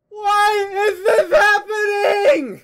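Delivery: high, slow, loud anguished cry; panicked wail. Retold or imitated. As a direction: shriek in mock despair. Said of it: "I'm just like".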